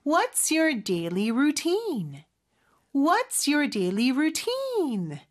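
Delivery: slow model sentence, "routine" lifted at the end; rising question ending. Shown as a demonstration. Teacher modelling a question for learners, "routine" slow and rising. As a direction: draw it out and raise the pitch on "routine". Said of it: "끝을 올려줘야 되겠네요".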